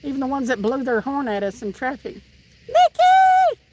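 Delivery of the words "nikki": loud call. Real, not acted. Calling out to Nikki across the house, loud and high. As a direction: shout the name out high and loud.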